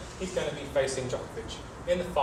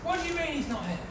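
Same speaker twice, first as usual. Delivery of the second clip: mock surprise; 9 semitones higher, 5 dB quieter, faster than usual. Acting surprise at absence.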